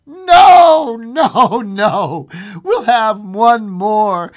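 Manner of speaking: loud, high drawn-out refusal; frantic insistence. A narrator's reading. Wife demanding another wish from husband, loud and frantic. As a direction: start at a high shout, then insist.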